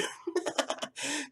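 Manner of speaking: soft unvoiced chuckle; breathy laugh. Real, not acted. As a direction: laugh under your breath, amused. Laughs at the funny part.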